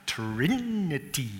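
TRIN-ity, first syllable drawn out slowly; slow Trinity, stretched at the front and falling away; stress on first syllable. Shown as a demonstration. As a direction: lean long on the TRIN of Trinity. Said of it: "Which syllable do you emphasize?".

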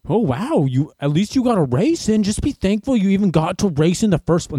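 high-pitched mock cheery naysayer; gushing critics. Runner mimics people telling them to be thankful, high mock gushing. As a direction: imitate people gushing that you should be grateful. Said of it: "people are gonna be like".

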